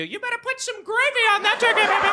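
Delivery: very high sing-song drawled scolding; sassy mom. Pretend. Mother scolding cocky son, high sing-song sass. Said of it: "she'll turn to you".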